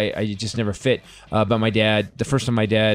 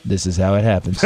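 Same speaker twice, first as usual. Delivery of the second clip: low, unruffled aside after the chaos; deadpan dad; 5 semitones lower than usual. Retold or imitated. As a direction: drop your pitch and state it matter-of-factly. Dad deadpans amid commotion, retold.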